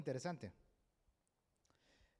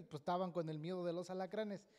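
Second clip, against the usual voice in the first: ordinary talk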